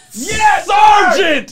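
very high, loud cheer; over-the-top celebratory holler; exultant shout. Pretend. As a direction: yell it in triumph. Cheering the accent being found.

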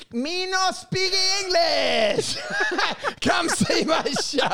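high, slow yelled broken English with laughter; slow high mock yell in broken English. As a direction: bellow it out front on a microphone, cracking up. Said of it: "And you're yelling".

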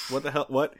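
normal talking voice

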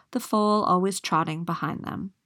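ordinary delivery, nothing marked

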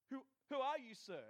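acted startled respect; freaked out. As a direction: stammer it out to an unknown authority. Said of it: "and you're freaked out".

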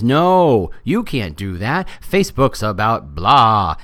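loud drawn-out "No", then slow, heavy protest; exaggerated slow contradiction; mock objection. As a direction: imitate a dismissive naysayer, stretching the words. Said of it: "pulls a blind man and says".

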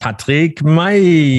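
name stretched out high and slow; ring announcer. Pretend. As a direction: draw the name out like a fight announcer. Announcer climax of introduction. (Name langgezogen wie ein Ringsprecher)

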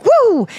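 high whooping skid noise, way up in pitch; tire squeal sound effect. Retold or imitated. Telling friends about the skid track, imitating the tires with a high woo. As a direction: mimic the tires sliding out with a bright woo. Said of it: "cool sound effects".

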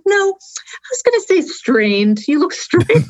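high, drawn-out mimicking voice; imitated reply. Recounting a video chat, her reply mimicked high and slow.

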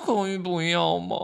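slow, drawn-out reluctant plea; pleading retold refusal. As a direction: drag it out like a coy little beg.

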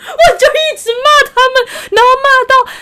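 high-pitched laughing through the words; cracking up while telling it; helpless laughter. Real, not acted. Laughing recalling scolding scammer.

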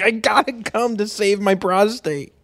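high-pitched whiny dad impression; high mock dad voice. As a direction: squeak it like a fussy hypochondriac. Imitating hypochondriac dad.

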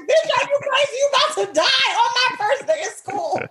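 high-pitched comic alarm; hammed-up, frantic scolding; mock panic. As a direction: shriek it in exaggerated disbelief. Acts out panicked reaction to mom.

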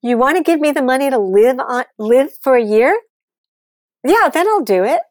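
half-acted shouting match, pushing her pitch up; retold yelling at agent. Retelling how she yelled at her agent, a loud mock yell. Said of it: "And I'm screaming at him".

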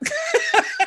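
hoge, uitbundige lach; high laugh. Real, not acted. Laughing at the joke.

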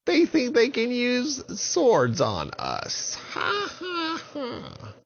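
high cackling witch voice; mocking high witch with cackle. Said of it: "And the witches said".

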